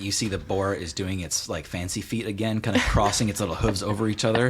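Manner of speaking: ordinary talk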